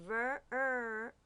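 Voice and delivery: "ver", then its vowel "er" alone; sounding out syllable. Shown as a demonstration. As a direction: hold "er" as a separate R-coloured vowel. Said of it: "we hear er".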